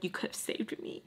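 whispered plea. Retold or imitated. Child's inner plea, retold.

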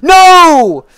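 full panic scream. Real, not acted. Gamer screaming as the run ends, ear-splitting.

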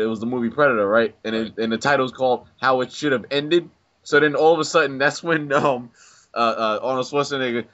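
ordinary talk

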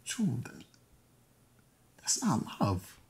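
soft whisper to ex about love; tender whisper. Acted. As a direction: gently tell her the truth.

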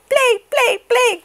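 very high, loud chant; very high excited baby goose. Acted. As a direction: beg to play as a tiny goose. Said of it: "cried the excited baby goose".

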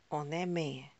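ordinary delivery, nothing marked

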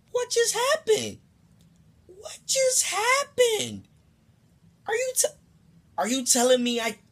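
very high, drawn-out mock disbelief; hammed-up incredulous squeal; shocked disbelief. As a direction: squeal it slowly like a stunned child. Retold shock at being robbed.

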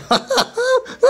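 very high-pitched giggling squeal; manic cackle. Acted. Faux tycoon gloating over his tea, manic cackle.

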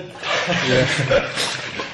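breathy laugh. Real, not acted. Athlete laughing in ice bath.

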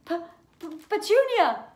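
stammering, high, loud gasp of shock. A narrator's reading. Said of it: "he gasped".

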